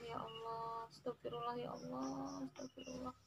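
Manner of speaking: very soft, hushed prayer full of regret; soft repentant prayer. Shown as a demonstration. Mother shows her children a remorseful prayer, very softly. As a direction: plead to God quietly, as if close to tears.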